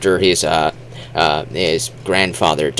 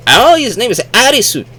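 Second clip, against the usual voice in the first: high, loud quote with tapped "Arisu"; imitated Japanese speaker; 5 dB louder than usual. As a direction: announce the name in a high mock voice. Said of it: "They would say".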